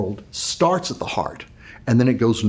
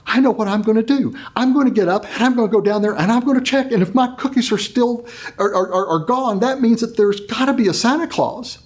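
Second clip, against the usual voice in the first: put-on much higher, determined child's voice, stumbling on the words; a small boy talking himself into checking; hopeful little boy; 8 semitones higher than usual. Billy alone in bed, resolving to check the cookies, high and hopeful. Four-year-old planning in his bed.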